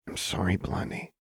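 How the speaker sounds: breathy, remorseful whisper; apologetic whisper. A narrator's reading. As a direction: whisper the apology close to her. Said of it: "Jinx whispered".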